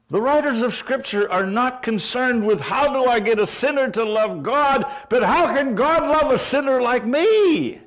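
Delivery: fervent preaching. Acted. Preacher asking congregation the gospel question, fervent. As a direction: drive the question home.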